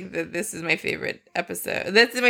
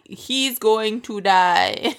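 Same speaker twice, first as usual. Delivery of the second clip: very slow, drawn-out doom voice; ominous slow warning; much slower than usual. Retold or imitated. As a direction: drag it out like a warning siren. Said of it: "put a red flashing light".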